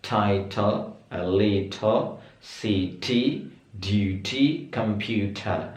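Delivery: "little", "city", "computer" with a clear middle T, slow; crisp British T. Shown as a demonstration. Teacher modelling clear British T for students. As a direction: say each middle T crisply and slowly. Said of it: "T yang di tengah itu pengucapannya jelas banget".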